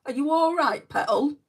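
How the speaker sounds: high, loud mock-Yorkshire endearment; Yorkshire accent. Shown as a demonstration. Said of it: "they say petal a lot".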